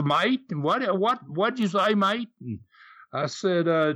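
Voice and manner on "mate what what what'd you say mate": lightly imitated Aussie cabbie, pitched up; puzzled Australian driver, voice lifted high; Aussie cabbie accent. Cab driver asking the Southern passenger to repeat, mock Aussie accent. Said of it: "that Aussie accent".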